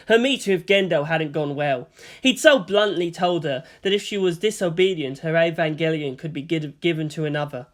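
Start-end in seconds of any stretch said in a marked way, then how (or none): none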